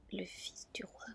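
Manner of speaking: usual speaking voice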